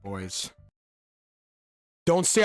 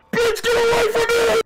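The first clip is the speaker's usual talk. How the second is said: very high, loud screaming; panicked screech; 7 dB louder than usual. Real, not acted. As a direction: screech to drive someone away. Recoils from creepy character.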